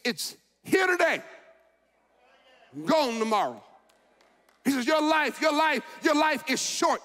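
loud, high, fervent preaching. Acted. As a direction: preach it out with full force to the church. Preaching at full force.

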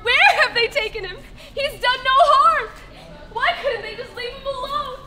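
high-pitched panicked searching, building to loud anguish; frantic mourner demanding answers; distraught. Acted. Acted grief at the empty tomb.

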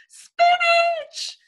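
put-on very high, loud child's shriek; delighted squeal. Little P seeing the dessert, squealing. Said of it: "squealed Little P".

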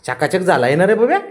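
loud, high cooing to a pet; gushing praise. Real, not acted. Owner praising the freshly bathed pet, gushing loudly. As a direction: praise the pet with a big lifted question.

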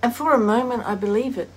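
reflective dramatic reading with long pauses; measured, pensive recital, fading at phrase ends; wistful monologue. Acted. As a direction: deliver a heartfelt soliloquy on loss, phrase by phrase. Performs a written monologue about loss.

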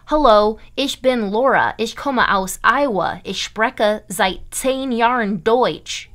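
German said with flat American vowels and r's; heavy American accent. Shown as a demonstration. Teacher demonstrating a bad American accent in German. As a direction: read it like an Iowan who never tried the sounds. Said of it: "You don't want to sound like this".

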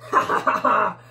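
loud, fast mock evil laugh; villain laugh. Bully bug laughing at the tiny bugs, a hammed-up evil laugh. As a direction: cackle like a greedy bully.

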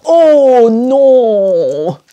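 "Oh, non" loud and groaning in dismay; dismayed French no. Shown as a demonstration. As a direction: moan a heartfelt oh no.

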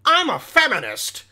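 imitating a self-declared feminist guy; mocked male feminist. Guy declaring himself a feminist, mocking imitation. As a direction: mockingly announce it, putting on a voice. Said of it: "when a guy's like".

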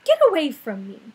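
loud angry shout. Acted. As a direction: shout him away. Rainbow Fish sends fish away.

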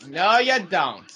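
defiant. Real, not acted. Blocking rival.

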